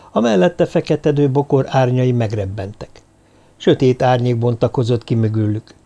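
usual speaking voice

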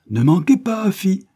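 loud, high call after someone; shouted reminder. A narrator's reading. Ebenezer calling after Afi as she leaves, shouting. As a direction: shout it after her retreating back. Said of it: "cria de nouveau monsieur Ebenezer".

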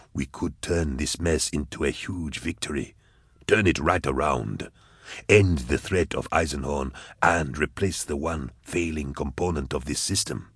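low, soft calculating schemer; quietly scheming, voice dropped low; scheming villain. Acted.

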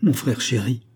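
plain reading voice

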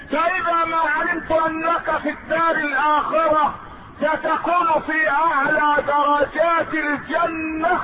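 high, drawn-out preaching cadence; intoned preaching. Acted. Companion telling the Prophet of his longing, intoned preaching. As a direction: sustain each phrase high, stretching the final words.

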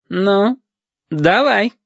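high, dismissive go-ahead; grunted assent. A narrator's reading. Lieutenant lets the condemned boy speak, snorting. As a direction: give leave with a high little snort. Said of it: "хмыкнул Хариус".

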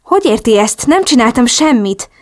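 narrator's mildly acted bafflement; flustered protest. A narrator's reading. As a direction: protest innocence in confusion. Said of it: "hebegtem zavarodottan".

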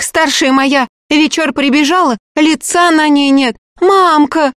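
high, loud agitated woman. A narrator's reading. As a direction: rattle it off scared and shrill-voiced. Frightened peasant woman tells news.

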